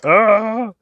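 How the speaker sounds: high, loud startled ah; sudden alarmed yelp; startled cry. Real, not acted. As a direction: yelp at a mistake. Spots a missed patch.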